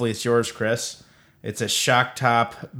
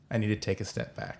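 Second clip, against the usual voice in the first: much softer, as if to yourself; quiet admission; 10 dB quieter than usual. Real, not acted. Repeats admission to himself, much softer.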